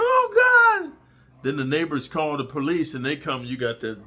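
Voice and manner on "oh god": very high, loud falsetto shriek; hammed-up woman screaming in shock; mock scream. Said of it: "She starts screaming".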